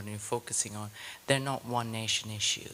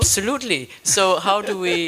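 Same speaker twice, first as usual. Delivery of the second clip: bursts out much higher and louder; emphatic agreement; 8 semitones higher, 13 dB louder than usual. Real, not acted. Academic eagerly agreeing with the speaker, with a loud excited burst. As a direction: seize on the point with a big excited "absolutely".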